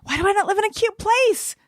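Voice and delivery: high, whiny wail over the repeat; mock whine. Friend repeats her lament about not living somewhere cute, mock whining. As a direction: moan it like a jealous complaint.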